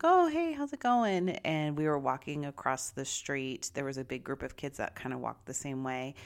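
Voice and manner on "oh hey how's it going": bright friendly greeting. Retold or imitated. Retold friendly greeting to new kid.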